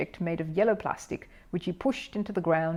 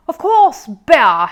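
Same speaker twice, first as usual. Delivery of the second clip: much higher, much louder showy boast; loud announcement; 7 semitones higher, 14 dB louder, much slower than usual. A narrator's reading. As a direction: announce it for the spectators to hear. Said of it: "he announced in a loud voice".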